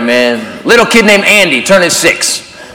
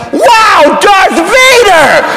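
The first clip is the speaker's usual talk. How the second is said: mock kid awe; 10 semitones higher, much slower than usual. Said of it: "did not look at me like".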